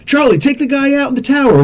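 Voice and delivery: high-pitched urgent command; mock urgent order. Ordering Charlie to take the shot, mock urgent. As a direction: call it out like a squad order. Acted scene, ordering the sniper.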